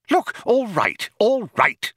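high-pitched, strained impatience; exasperated. A narrator's reading. Loonquall to the evasive computer, exasperated. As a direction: cut in, high and fed up.

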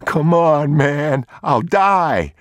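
a starving thief begging the father; desperate thief. Acted. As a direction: cry out your hunger.

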